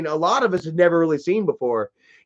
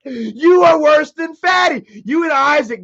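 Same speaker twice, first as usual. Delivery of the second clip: real shout; 8 semitones higher than usual. Real, not acted. Yelling at troll Nick.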